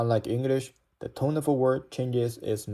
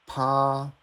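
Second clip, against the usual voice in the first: "趴" high and level; first tone; 3 semitones higher than usual. Shown as a demonstration. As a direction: hold "趴" long on a flat pitch. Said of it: "the logic is all the same".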